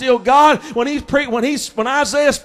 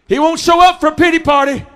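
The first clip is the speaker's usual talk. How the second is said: higher, louder shout; preached shout; 4 semitones higher, 3 dB louder, faster than usual. Acted. Preacher shouts to the church about pity. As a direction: punch it out to the church.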